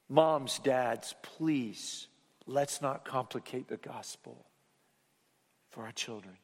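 ordinary delivery

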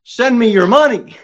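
high mock preacher voice begging; mock televangelist plea; greedy televangelist. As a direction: sneer it like a money-hungry preacher.

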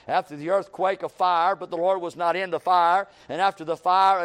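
solemn scripture read. Acted. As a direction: read the King James passage with weight. Reading scripture aloud.

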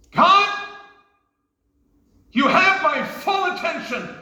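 high, loud call to God, then slow; a pleading exclamation to God; fervent prayer cry. Shown as a demonstration. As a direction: cry out to God with full attention. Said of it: "What does the word wait mean?".

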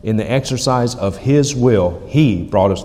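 HIS and He firmly accented; stress on His, He. Shown as a demonstration. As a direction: hammer home "His" and "He". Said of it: "That's there for emphasis".

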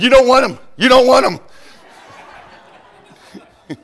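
loud, high playful yell; laughing shout. Retold or imitated. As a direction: call out laughing to the girls. Preacher shouts to girls, laughing.

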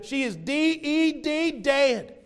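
"D E D" spelled slow and high, then "dead" in an Appalachian accent. Shown as a demonstration. Pastor joking about how Appalachians say dead, Appalachian accent. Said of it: "If you're from Appalachia".

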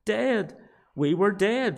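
preacher hammering "dead"; stress on dead. Real, not acted. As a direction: hit the word "dead" emphatically. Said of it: "I'm emphasizing the word dead".

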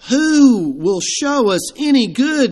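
slow, high, sighing lament; defeated sigh. Retold or imitated. Despondent men voicing their defeat, slow and sighing. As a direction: let the question sag in defeat. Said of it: "a defeated sigh".

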